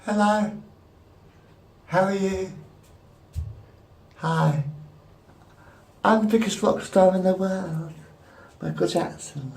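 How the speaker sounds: high-pitched impression of a famous pop star. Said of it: "this is my impression of [name]".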